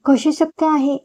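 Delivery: high, mildly disbelieving question. Acted. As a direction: ask as if you can't believe it. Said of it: "अविश्वासाने जय म्हणाला". (अविश्वासाने, किंचित उंच स्वरात विचारलेला प्रश्न)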